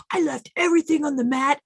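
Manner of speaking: proud gym-goer quote. Retold or imitated. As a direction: play a proudly spent athlete, lightly. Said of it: "Some people leave like".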